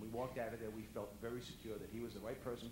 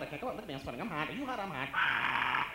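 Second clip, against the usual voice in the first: 1 mom's frantic voice; 4 semitones higher, 11 dB louder than usual. Retold or imitated. Mom's hot-flash voice again.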